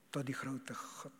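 whispered, hushed awe; whispered sermon phrase; reverent whisper. Acted. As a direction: breathe it in awe.

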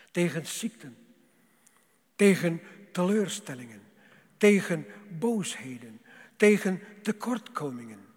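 loud rhythmic pulpit list; building preaching. Acted. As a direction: drive each tegen home. Preacher lists struggles rising.

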